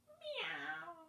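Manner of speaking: high mewing meow; cat meow. Shown as a demonstration. Teacher showing kids what cats say. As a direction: mew like a cat. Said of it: "What do cats usually say?".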